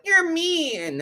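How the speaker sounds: offended viewer. Retold or imitated. Mimicking upset viewers who call her mean, high and whiny. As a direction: pout it like a touchy viewer. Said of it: "'cause some people are like".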